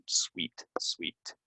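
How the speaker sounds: "Sweet" for suite, spoken softly; pronunciation demo. Shown as a demonstration. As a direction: model "sweet" softly as a lone word. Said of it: "That's called a sweet".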